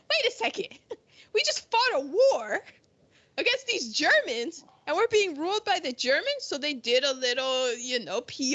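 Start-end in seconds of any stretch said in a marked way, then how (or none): 0.1-6.4 high-pitched mock outrage; indignant British public. British public protesting German rulers after the war, mock indignant. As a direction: protest in raised, incredulous pitch. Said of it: "British people would be like".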